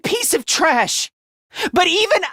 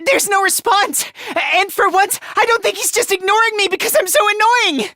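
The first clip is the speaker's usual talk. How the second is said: high, rushed alarm; panicked; 8 semitones higher, faster than usual. Acted. Fighter reporting no response from comrade, panicked. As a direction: race upward into panic.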